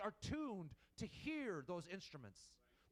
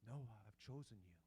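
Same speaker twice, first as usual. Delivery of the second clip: low, soft hush in the night; soft nighttime whisper; 11 semitones lower, 9 dB quieter than usual. Pretend. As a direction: breathe it quietly to him. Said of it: "whispering to him".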